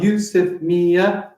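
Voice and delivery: slow, separated beats on "Yusuf Mia"; measured demo of "Yusuf Mia"; syllable by syllable. Shown as a demonstration. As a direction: count out "Yusuf Mia" in four. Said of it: "That's four".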